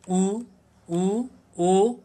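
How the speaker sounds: उ, ओ spoken with rounded lips; rounded vowel demo. Shown as a demonstration. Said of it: "इसका उच्चारण करके भी आप देख सकते हैं". (वृत्ताकार स्वरों का उच्चारण)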